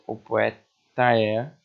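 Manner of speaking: "O poeta é" said slowly, final "ta" sliding into "é"; slow linked syllables. Shown as a demonstration. Teacher showing students the joined final syllable. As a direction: run "poeta é" together at a slow pace. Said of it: "olha só como a gente junta".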